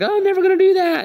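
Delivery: high, quick protest; indignant Peter. Retold or imitated. Peter protests to Jesus he'd never deny him, high indignant protest. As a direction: blurt it out high and fast.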